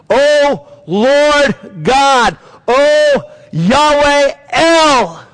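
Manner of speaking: drawn-out preached prayer; fervent prayer. Acted. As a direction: cry out to God with full force. Reading Nehemiah's prayer in fervent preaching.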